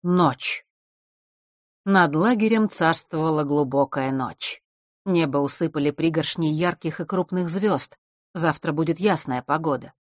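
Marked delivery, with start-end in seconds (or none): none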